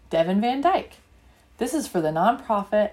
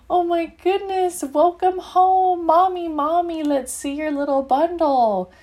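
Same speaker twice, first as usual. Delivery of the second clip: higher, delighted greeting at the door; light up, they're finally home; excited welcome; 7 semitones higher, 3 dB louder than usual. Acted. Children greeting Mommy and the new baby, excited and higher.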